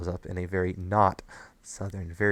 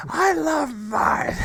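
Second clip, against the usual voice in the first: high-pitched, drawn-out mad squeal; crazed character quote; 6 dB louder, much slower than usual. Retold or imitated. Co-host quoting Rarity going completely insane, crazed squeal. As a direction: squeal it out slowly, completely unhinged.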